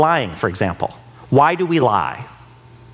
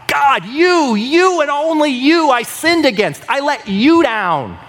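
much higher and louder, crying out; passionate cry to God; 9 semitones higher, 3 dB louder than usual. Retold or imitated. David crying out his sin to God, passionate and loud. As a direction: pour it out to God. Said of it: "He's calling out to God".

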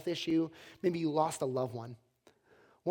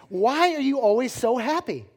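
louder, higher-pitched incredulous question; exasperated demand; 6 semitones higher, 7 dB louder than usual. Acted. Buddy questioning happy cook, exasperated. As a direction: demand an explanation, mock exasperated.

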